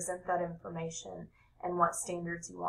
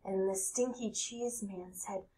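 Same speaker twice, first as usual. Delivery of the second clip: plain reading voice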